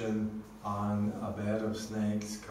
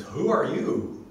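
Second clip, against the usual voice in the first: higher, louder startled question; startled demand; 7 semitones higher, 7 dB louder than usual. Acted. Brahma questioning the unknown voice, startled and loud. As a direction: demand it, pitched up in mock surprise.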